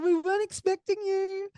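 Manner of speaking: speaking to the newborn with joyful wonder; tender delight. Retold or imitated. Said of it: "I said".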